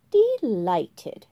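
loud, self-satisfied; preening delight. Acted.

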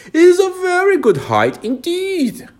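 very high, loud caterpillar voice; offended caterpillar, high and loud; angry caterpillar. A narrator's reading. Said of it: "said the caterpillar angrily".

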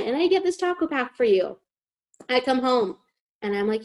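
ordinary talk

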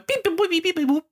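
high electronic beep boop noises; robot beeps. Retold or imitated. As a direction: beep like a little spaceship. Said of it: "It goes".

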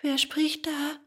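high, timid puppet voice; frightened little puppet; trembling question. A narrator's reading. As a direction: ask nervously, pitched high. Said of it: "fragte Bengele und zitterte noch mehr".

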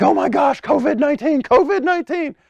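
very high-pitched panicked squeal; mock freak-out. Preacher mocks God panicking over covid, high freak-out. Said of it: "it's not freaking him out".

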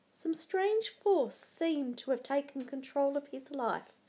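ordinary delivery

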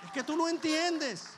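"no" hit loud and high in "tú no entiendes"; emphatic. Real, not acted. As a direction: preach it out loud, pressing "no". Preacher drives the point. (proclamación enfática, "no" con fuerza)

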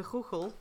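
"Google" with a raspy kh for each g; Dutch pronunciation. Shown as a demonstration. Podcaster reading out a search source, Dutch-style "Google". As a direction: say "Google" with throaty Dutch g sounds. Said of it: "this is Dutch pronunciation".

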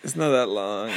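ordinary delivery

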